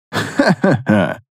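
slow low laugh; hearty laugh. A narrator's reading. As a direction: laugh it off warmly. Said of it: "засмеялся тот".

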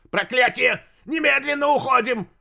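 high, loud panicked cry; panicked wail. Acted. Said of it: "магистр неожиданно заголосил".

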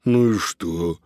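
snorted, scornful question; dismissive snort. A narrator's reading. Tramp cat shrugs off his friend's worry, snorting. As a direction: brush it off with a snort. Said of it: "фыркнул Додо".

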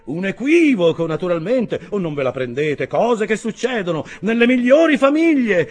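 high, loud stream of fragments; take-charge stranger holding forth loudly; voluble nonstop talker. A narrator's reading. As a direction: rattle off orders and reassurances to all around. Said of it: "dette con straordinaria volubilità".

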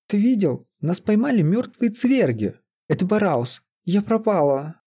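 high-pitched, distressed dismay; acted panic. A narrator's reading. Trapped Лиза tells the sorcerer in acted panic.

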